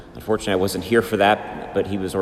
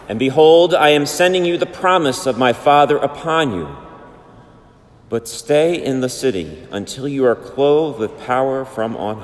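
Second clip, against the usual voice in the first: Gospel reading; 3 semitones higher than usual. Real, not acted.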